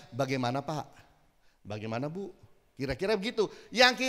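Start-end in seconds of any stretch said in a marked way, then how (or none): 0.1-2.3 "Bagaimana, Pak? Bagaimana, Bu?" lower and softer, gently asking; polite intonation. Shown as a demonstration. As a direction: ask it respectfully, calmly.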